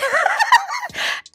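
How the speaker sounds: high, shouted laugh; burst of laughter. Real, not acted. Laughing at Tyreek.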